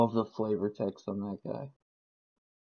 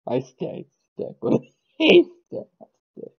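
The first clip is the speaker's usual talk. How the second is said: laughing; 10 semitones higher than usual. Real, not acted. Laughing while telling.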